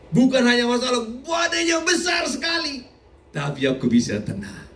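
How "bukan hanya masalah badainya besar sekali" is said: preaching shout. Acted. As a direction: belt it out over the storm. Preaching about a great storm.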